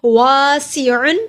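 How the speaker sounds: वासिउन with a long वा, slow; lengthened vowel. Shown as a demonstration. Teacher demonstrating a long vowel to students. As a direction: stretch the वा in वासिउन. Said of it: "वाव को लंबा किया".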